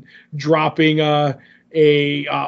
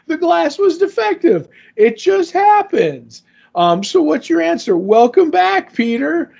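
much higher, breezy put-on voice for the comic character; high mock voice; 12 semitones higher than usual. Host voicing a comic character dismissing a conspiracy, high mock voice. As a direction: lift the voice way up and brush it off lightly.

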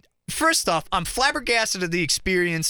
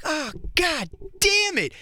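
higher, slow, fed-up mock groan; exasperated groan; 8 semitones higher, slower than usual. Paying date stuck at mime show, exasperated groan. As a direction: let the annoyance stretch out. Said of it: "I'm like".

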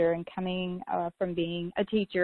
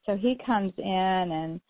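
ordinary delivery

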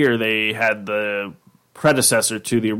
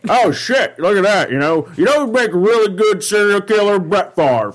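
high, loud excited commentator; hyped football announcer voice; John Madden impression; 8 semitones higher, 3 dB louder than usual. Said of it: "that's John Madden".

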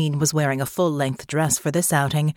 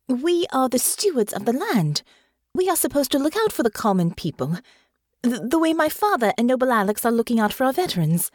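higher and faster, building conviction; young noblewoman finding her voice; earnest young Honor; 7 semitones higher than usual. Acted. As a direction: argue it out eagerly. Honor argues her case.

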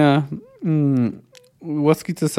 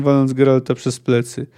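plain reading voice

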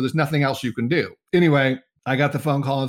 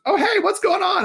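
high-pitched greeting. Retold or imitated. Client spots agent from top of stairs, high-pitched greeting. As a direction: imitate her in a very high voice.

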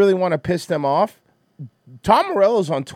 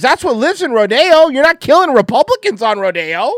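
higher and louder, heated and building; loud, high-pitched exasperated rant; excited rant; 11 semitones higher, 7 dB louder than usual. Real, not acted. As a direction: push the point up and out, getting wound up. Worked-up rant about Rodeo.

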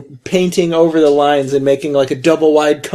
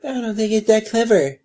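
higher, softer mimicking echo; mocking parroting; 5 semitones higher, 5 dB quieter than usual. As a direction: parrot it back to tease. Mockingly parrots the other speaker.

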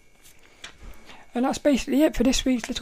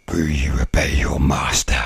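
a whispered, breathy demon voice from far off; ghostly whispered master voice; deep demon voice; 4 dB louder than usual. Retold or imitated. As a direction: hiss it like a demon testing you. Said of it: "in a really deep voice".

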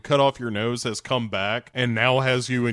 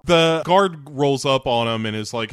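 normal talking voice

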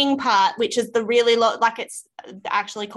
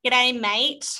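Australian greeting; 2 semitones higher than usual. Shown as a demonstration. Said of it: "Gave you an Australian one".

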